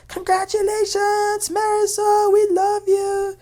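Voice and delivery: high drawn-out congratulatory yell; mock scream. Recapper imitates Julia screaming congratulations to Marisol, mock scream. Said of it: "she screams".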